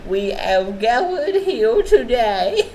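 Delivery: high, loud, slow mock-solemn opening; mock funeral sermon, giggling; mock eulogy. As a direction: ham up a funeral preacher through laughter.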